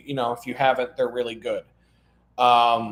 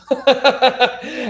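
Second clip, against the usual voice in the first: loud laugh; 10 semitones higher, 6 dB louder than usual. Real, not acted. Laughing about friends' story.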